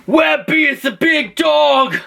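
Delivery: high, drawn-out challenging call; hammed-up warlord's bellow; invading king. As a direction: call out the rival leader theatrically.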